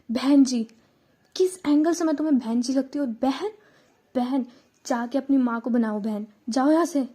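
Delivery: loud, fast, furious rant; indignant fury, spitting it out; angry outburst. Acted. Megha telling off the guy who called her sister, loud and angry.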